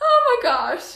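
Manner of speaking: high, loud, overwhelmed exclamation; real tearful squeal; happily tearful. Real, not acted. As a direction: burst out on the edge of happy tears. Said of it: "This is gonna make me cry".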